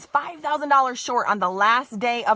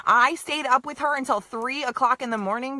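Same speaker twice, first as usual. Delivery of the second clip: ordinary delivery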